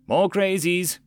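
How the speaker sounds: high-pitched call-out; yelled warning. A narrator's reading. Greg warning the crew from the rear, yelled warning. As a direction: shout it to the others. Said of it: "Greg yelled from the rear".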